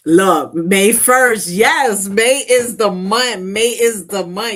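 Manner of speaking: slow, loud and high, thrilled about May; hyped announcement of the month; loud excited cheer. Real, not acted. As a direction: savor each word with glee. Excited about May, reading chat.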